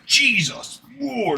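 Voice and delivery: slow, high, drawn-out groan of disgust; mock disgust. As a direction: stretch it out like the smell hits you. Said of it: "you'll go in there like".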